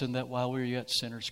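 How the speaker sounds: ordinary talk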